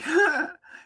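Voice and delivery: short high laugh breaking through emotion; a real laugh that catches in the throat; teary laugh. Real, not acted. Praising the woman who saved him, a tearful laugh.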